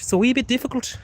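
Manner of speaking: high-pitched Irish lilt; Irish accent. Shown as a demonstration. Streamer replaying his slip for viewers, Irish accent. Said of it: "I switched into Irish".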